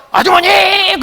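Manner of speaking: high ringing call of a woman's name. Retold or imitated. Preacher calling to the daughter-in-law in her dreams, high call.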